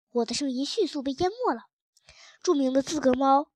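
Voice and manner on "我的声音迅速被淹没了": ordinary delivery, nothing marked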